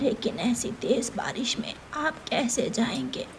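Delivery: put-on whispered, anxious old mother; old bird mother whispering her worry to Kuku; worried whisper. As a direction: breathe the question out with concern.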